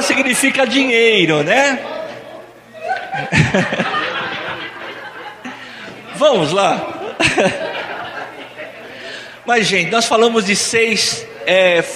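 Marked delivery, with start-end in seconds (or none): 2.8-5.5 quiet breathy laughter; soft laugh. Real, not acted. Room laughs at the joke. 6.9-8.7 quiet airy chuckle; soft laugh. Real, not acted. Keeps laughing after joke.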